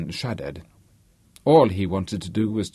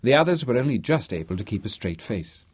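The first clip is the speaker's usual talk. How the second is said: plain reading voice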